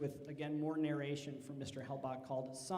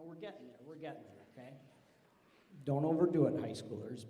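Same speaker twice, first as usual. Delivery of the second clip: ordinary delivery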